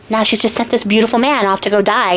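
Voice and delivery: usual speaking voice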